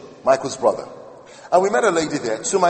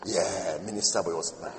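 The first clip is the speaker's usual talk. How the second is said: much lower, quieter mock voice of a suspicious woman; imagined woman; 10 semitones lower, 9 dB quieter than usual. Preacher imagines the woman doubting him, low and suspicious. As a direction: drop low and dubious, doubting the guy. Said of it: "I didn't want to have walking around thinking".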